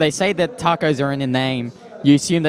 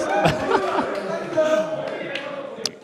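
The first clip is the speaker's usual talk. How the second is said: high shrieking burst of laughter; 14 semitones higher, 5 dB quieter than usual. Real, not acted. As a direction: break into a squealing laugh. Laughing at the nickname.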